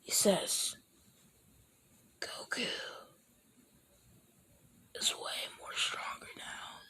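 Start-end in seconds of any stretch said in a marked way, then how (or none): 2.2-6.8 breathy secret whisper; hushed whisper to Bulma. Acted. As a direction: whisper it so only Bulma hears.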